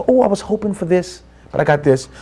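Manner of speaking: soft, slightly let-down voice; mild disappointment. Acted. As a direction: act a little disappointed, dropping the volume. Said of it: "a little bit disappointed".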